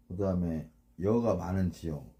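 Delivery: usual speaking voice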